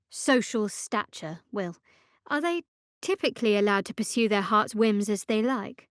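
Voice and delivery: normal talking voice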